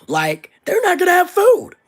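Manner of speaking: high, loud burst of outrage on food; outraged cry. Real, not acted. Vlogger raging to viewers about families losing food, high loud outrage. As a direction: cry out in disbelief.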